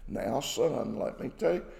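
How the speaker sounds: soft, low fatherly reproof; gentle, loving parent. Acted. Parent talking to son about hitting his sister, gentle and loving. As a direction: gently correct a child, voice dropped. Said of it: "a loving exchange".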